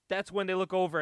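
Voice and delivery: usual speaking voice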